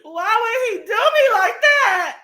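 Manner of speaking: high, loud, slow lament; hammed-up anguished cry; mock heartbroken wail. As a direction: wail it slowly, playing the jilted lover. Hammed-up heartbreak over cheating.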